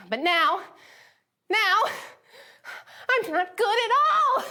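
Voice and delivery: high, loud, wailing break; anguished cry. Retold or imitated. Spinel crying out her despair to Steven. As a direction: let the voice crack upward in despair.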